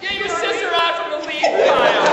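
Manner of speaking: high, loud parental holler; yelled order. Acted. Father to son about his sister, yelled. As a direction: shout an urgent order to your kid.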